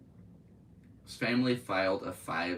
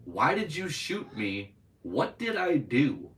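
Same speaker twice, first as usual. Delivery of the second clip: slow, higher reading of a hurt question; pleading child quote; 8 semitones higher, 6 dB louder, slower than usual. Acted. Reading the shot boy's words to his mother, lightly pleading. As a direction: lightly voice a bewildered plea.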